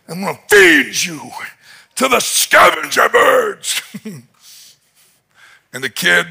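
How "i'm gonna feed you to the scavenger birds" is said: rising, louder threat; yelling threat. Retold or imitated. Goliath threatens the boy David, mock yelling. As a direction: build the threat toward the birds. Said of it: "he just starts yelling at the kid".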